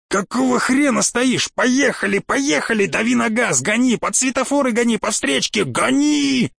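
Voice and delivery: high-pitched, desperate command to drive off; panicked exclamations pitched high, peaking on the last word; frantic urging. Acted.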